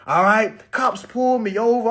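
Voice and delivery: ordinary talk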